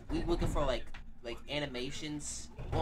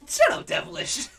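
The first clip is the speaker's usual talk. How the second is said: loud, very high silly yell; over-the-top scolding yell; mock outburst; 10 dB louder than usual. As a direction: shriek it in mock outrage.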